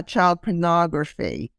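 ordinary talk

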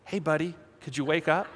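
very soft, tentative mock nudge; hushed, overly polite wake-up; gentle waking. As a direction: softly coax a sleeper awake. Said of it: "and it wasn't like".

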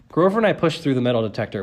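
ordinary delivery

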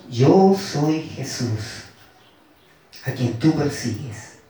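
low and slow, every word weighed; lightly voiced Jesus, deliberate and grave; solemn voice of Jesus. A narrator's reading. Preacher voicing Jesus answering Saulo, slow and low. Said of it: "Y le dijo".

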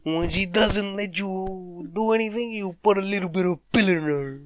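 Bill Cosby impression. Comic redoing Bill Cosby for his mate, exaggerated impression. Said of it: "doing the Bill Cosby accent".

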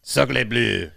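hammed-up French exclamation; cringy French accent. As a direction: put on a corny French accent. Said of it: "cringy impersonation".

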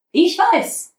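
plain reading voice

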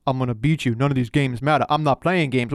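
low-pitched wrestler imitation; Omos. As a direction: drop the pitch for a dismissive big-man quote. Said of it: "Omos is like".

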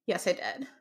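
usual speaking voice

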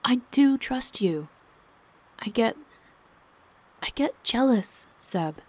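high, halting admission; earnest confession. A narrator's reading. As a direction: plead earnestly, hesitating before the confession. Wife earnestly confessing jealousy to husband.

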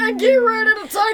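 hype announcer. Pretend. Announcer build-up to shout.

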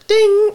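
high, sustained bell-like ding; elevator ding. Acted. Player mimics the elevator at each floor, high ding. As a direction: sing out a high elevator chime. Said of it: "every single floor just says".

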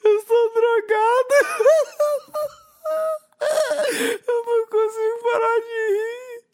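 high-pitched helpless laughing fit; giggling uncontrollably, laughing through pain; laughing gas. Real, not acted. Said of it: "Gás do riso". (rindo sem parar com gás do riso)

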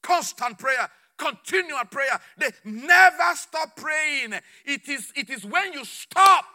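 loud, driving pulpit delivery; impassioned preaching. Real, not acted. Preacher urging people never to stop praying, impassioned. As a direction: build to a shouted final word.

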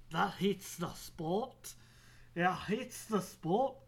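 drawn-out mock satisfaction; hammed-up refreshed exclamation; silly put-on voice. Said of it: "I don't know what I'm doing there".

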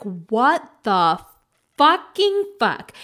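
replaying the moment she woke up in shock; mock shocked outburst. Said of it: "Except I didn't say this".

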